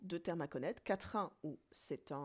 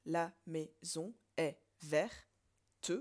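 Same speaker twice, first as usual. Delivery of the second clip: very slow, syllable by syllable; slow syllable count; 3 dB louder, much slower than usual. Shown as a demonstration. Teacher demonstrating the naive syllable count slowly. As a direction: drag out every syllable to count it. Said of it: "logiquement, sauf que".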